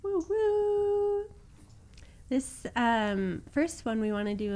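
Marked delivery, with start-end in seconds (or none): 0.0-1.2 high hooting woo woo like a train whistle. Pretend. Cohost joining the season-leaving-the-station joke with a train whistle. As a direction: toot it out like a train.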